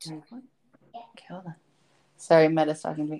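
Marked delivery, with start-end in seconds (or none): 0.1-1.5 quick whisper to someone nearby; whispered aside. Real, not acted. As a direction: drop to a whisper for the interruption.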